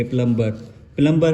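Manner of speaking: प्लम्बर with the ब clearly after m; sounded b. Shown as a demonstration. Teacher showing the wrong reading, b sounded. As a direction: keep the b in प्लम्बर. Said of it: "हम पढ़ेंगे वो गलत हो जाएगा".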